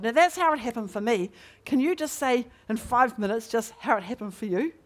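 usual speaking voice

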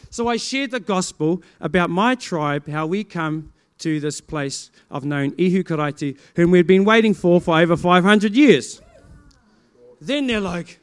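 ordinary talk

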